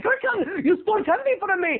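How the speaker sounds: high, demanding mock Indian accent; hammy Indian-accented shop owner. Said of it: "giving this guy an Indian accent".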